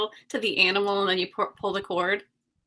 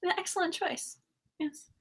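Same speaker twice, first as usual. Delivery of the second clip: fake British accent; 8 semitones higher, 9 dB quieter than usual. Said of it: "We're matching with our fake British accents".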